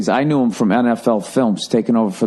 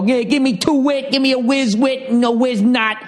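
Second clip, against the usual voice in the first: higher mock Philly accent ordering a cheesesteak; mocking Philly accent, pitched up; 12 semitones higher than usual. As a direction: rattle it off like an impatient Philly counter guy. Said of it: "you're doing that Philly thing".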